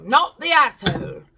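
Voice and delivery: loud, put-on British verdict; British accent. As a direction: announce it in a playful British accent. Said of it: "I always go into a British accent".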